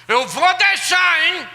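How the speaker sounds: loud high, slow grudging warning; grudging father. Retold or imitated. Strict father giving permission, loud and slow. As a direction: give in like a stern dad.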